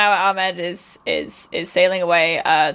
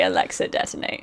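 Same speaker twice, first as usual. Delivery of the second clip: whispered command, no voice behind it; hushed whisper to the device; 13 semitones lower, 5 dB quieter than usual. Shown as a demonstration. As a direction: breathe the command under your breath. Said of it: "You have to say".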